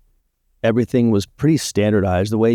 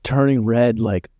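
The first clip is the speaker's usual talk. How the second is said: usual speaking voice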